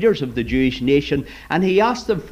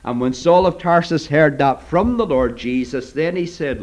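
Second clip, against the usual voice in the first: normal talking voice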